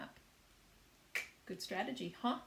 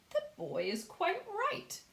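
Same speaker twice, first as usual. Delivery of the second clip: lightly voiced grasshopper; 10 semitones higher than usual. A narrator's reading.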